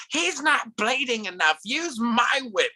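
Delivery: high, drawn-out mock villainess; imitated cruel wife. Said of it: "And she's like".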